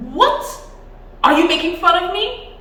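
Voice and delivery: high, loud indignant outburst; furious secretary yelling; angry shout. Acted. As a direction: shout it, losing your temper. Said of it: "shouted the secretary, losing his temper".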